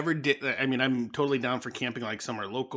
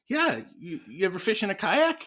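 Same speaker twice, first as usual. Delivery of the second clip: put-on high, eager little boy question; imitating son's high eager voice; high kid voice; 8 semitones higher, 3 dB louder than usual. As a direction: ask like a curious child. Child voiced in retold story.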